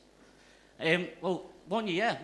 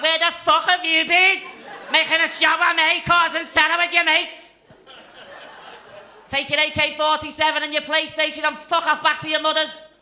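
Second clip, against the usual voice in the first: high, loud furious telling-off; furious Scouse girl scolding; 11 semitones higher, 15 dB louder than usual. Acted. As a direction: scream at him like an angry girlfriend. Scouse girl scolding Bin Laden.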